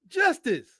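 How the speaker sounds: usual speaking voice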